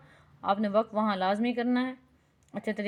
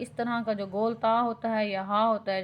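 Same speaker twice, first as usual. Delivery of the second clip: ordinary talk, nothing marked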